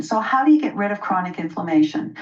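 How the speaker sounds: usual speaking voice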